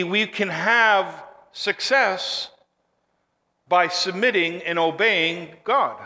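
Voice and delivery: ordinary talk, nothing marked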